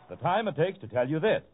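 usual speaking voice

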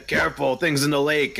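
high-pitched in-character call-out; shouted warning. Acted. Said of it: "I shout out".

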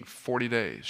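usual speaking voice

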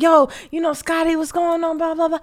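high, upbeat party greeting; hyped greeting. Retold or imitated. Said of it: "Everybody's like".

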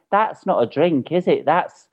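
high, exasperated complaint to a partner; indignant protest. Retold or imitated. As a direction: protest it in disbelief, stretching the ending. I was just angry.

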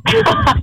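short high laugh. Real, not acted. Laughs after telling her story.

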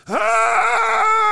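very high, loud pained cry; pained yelp. Acted. Joke teller acting patient's pain, very high loud yelp. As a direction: shriek out in sudden pain. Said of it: "Guy goes".

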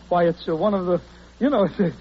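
ordinary talk

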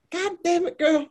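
high exasperated Southern boss; Southern US accent. Retold or imitated. Boss gives in to her, in exasperated Southern accent. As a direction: sigh it out like a fed-up Southern farmer. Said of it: "you are so good at the accent".

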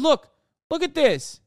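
usual speaking voice

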